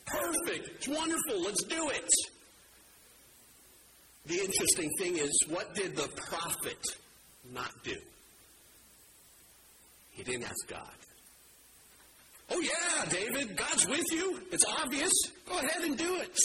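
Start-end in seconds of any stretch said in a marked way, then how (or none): 0.1-2.1 much higher, bubbly yes-man praise; mock enthusiastic cheerleading; gushing approval. Friend eagerly approving an idea. 12.5-16.4 much higher, gushing instant approval; eager yes-man. Retold or imitated. Nathan eagerly okays David's plan.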